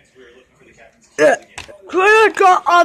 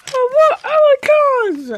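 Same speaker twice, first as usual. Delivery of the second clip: high, loud demanding shout; pretend demand; 11 semitones higher, 5 dB louder than usual. Toy figure yelling for its cars, high and loud. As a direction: shout it like a cross little figure.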